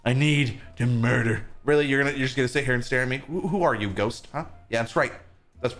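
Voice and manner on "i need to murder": low, soft menacing voice; murderous villain. Acted. Player turning killer in the game, murderous villain. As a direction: drop deep and quiet with menace.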